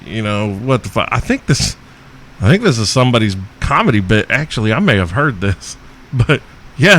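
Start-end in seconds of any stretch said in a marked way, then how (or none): none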